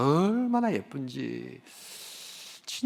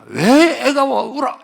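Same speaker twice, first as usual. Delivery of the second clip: louder, higher mock complaint at a crying baby; mock annoyed scolding; 8 semitones higher, 11 dB louder, slower than usual. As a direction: play the grumpy adult scolding the baby, half in jest. Imagined annoyed adult scolding baby. (우는 아기를 나무라는 어른 흉내, 과장된 짜증)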